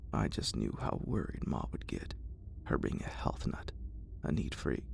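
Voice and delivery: ordinary delivery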